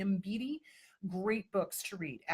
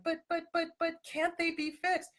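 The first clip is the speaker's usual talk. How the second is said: mock pleading question; 9 semitones higher than usual. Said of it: "They're like".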